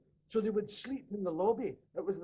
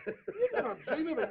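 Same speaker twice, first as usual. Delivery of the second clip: normal talking voice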